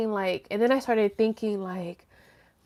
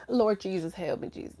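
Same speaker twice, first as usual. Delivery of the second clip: muttered prayer; 2 semitones lower, 9 dB quieter than usual. Retold or imitated. Exasperated prayer retold.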